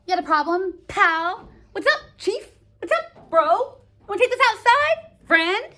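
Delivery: high, loud sarcastic taunts; mock tough-guy challenge. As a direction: fake politeness while picking a fight. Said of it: "It's kind of like how if you're like".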